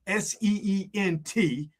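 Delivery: "S E E N T" spelled out slowly, letter by letter. Shown as a demonstration. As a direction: spell "S E E N T" one letter at a time. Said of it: "yes, I said synced".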